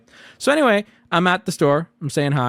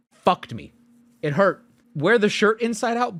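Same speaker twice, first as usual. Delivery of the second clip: usual speaking voice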